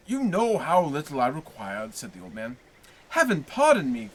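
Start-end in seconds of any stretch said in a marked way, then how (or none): 3.1-4.1 loud, high plea; crying out. A narrator's reading. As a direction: cry out for forgiveness.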